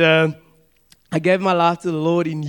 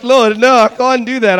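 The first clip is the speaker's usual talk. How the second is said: loud, raised plea of refusal; loud protest; 5 semitones higher, 8 dB louder than usual. Pretend. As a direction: cry out no to the Lord. Pleading refusal to God.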